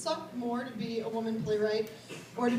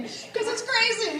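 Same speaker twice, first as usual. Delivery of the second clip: laughing, higher and slowed; 9 semitones higher, slower than usual. Real, not acted. Comedian to audience, laughing at the absurdity. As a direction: chuckle through it.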